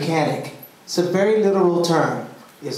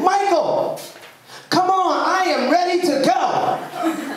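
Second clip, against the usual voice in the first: high, indignant call with a laugh in it, slower at the end; sassy demand; 14 semitones higher, slower than usual. Retold or imitated. Boy fed up in the hot car, calling Michael out high and sassy. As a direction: hands on hips, much higher, demanding to leave now.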